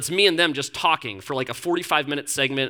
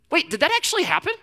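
surprised; 12 semitones higher than usual. Retold or imitated. Uncle fooled by niece.

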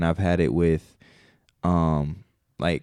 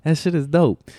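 high-pitched, impressed reaction; excited amazement; 10 semitones higher than usual. Retold or imitated. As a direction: retell it hyped up, pitched way up. Retelling amazed reaction to friend's role.

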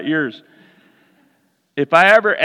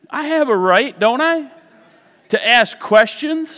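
higher, pleading objection, a touch of whine; protesting; 6 semitones higher than usual. Retold or imitated. Preacher acts himself arguing with God, higher protest. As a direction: push back like a kid asking why.

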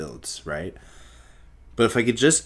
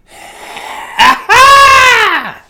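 very high, very loud laughing shout; shrieking laugh; 18 dB louder than usual. Real, not acted. Host hearing the sound of money, shrieking laugh.

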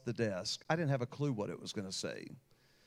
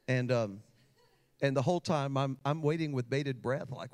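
usual speaking voice

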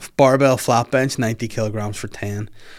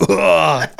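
loud, higher effort grunt; loud lifting grunt; 7 semitones higher, 8 dB louder than usual. Retold or imitated. Lifter showing what he thought he let out, loud grunt. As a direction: grunt as if heaving a heavy bar. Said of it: "I just thought I was like".

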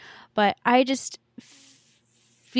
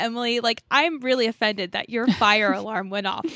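ordinary talk

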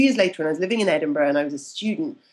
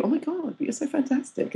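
high, softer mock gush; lightly voiced gushing admirer; 8 semitones higher, 4 dB quieter than usual. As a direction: imitate someone full of admiration. Acts out admiring people.

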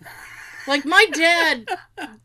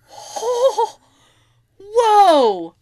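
shocked whoa; 9 semitones higher, 7 dB louder than usual. Retold or imitated. Reacting to dad's remark.

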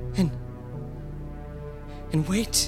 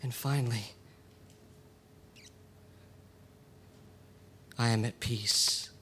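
at peace; 8 semitones lower, 13 dB quieter than usual. Acted. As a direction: let go, calm at last. Dying peaceful close.

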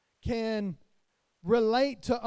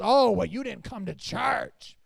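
mock scolding; 8 dB louder than usual. Mocking a scolding churchgoer.

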